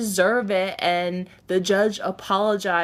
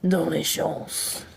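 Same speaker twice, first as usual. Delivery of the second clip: slow belched-out word; burped word; 4 semitones lower, 3 dB quieter, slower than usual. Shown as a demonstration. Burping a word for chat on request. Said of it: "Can you please burp a French word?".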